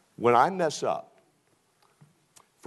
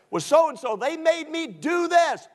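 excuse maker; 12 semitones higher, 4 dB louder than usual. Retold or imitated. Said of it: "Forget it".

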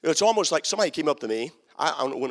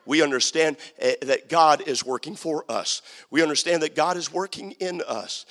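ordinary talk

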